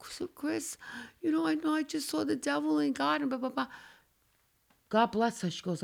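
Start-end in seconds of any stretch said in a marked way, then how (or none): 0.1-3.7 higher and much softer, a hint of teary panic; lightly imitating sobbing down the phone; a touch of a shaken woman calling her friend at night; retold hysterical crying. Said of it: "hysterical crying".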